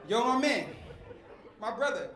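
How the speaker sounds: ordinary talk, nothing marked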